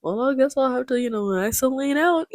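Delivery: high-pitched jokey teasing; put-on playful voice, pitched well up; joking. Ben jokes to Mrs. Robinson about Elaine, high and teasing. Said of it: "he jokingly says".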